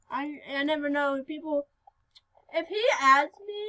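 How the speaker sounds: ordinary talk, nothing marked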